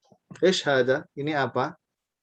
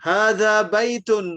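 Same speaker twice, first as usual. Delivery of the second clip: slow, loud and higher, every syllable drawn out; teacher model reading; 6 semitones higher, 6 dB louder, slower than usual. Shown as a demonstration. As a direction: read it clearly for the class to repeat. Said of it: "saya baca, Bapak Ibu tirukan". (guru membaca pelan dan keras untuk ditirukan)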